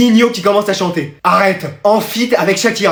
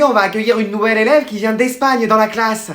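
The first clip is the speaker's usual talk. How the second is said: ordinary talk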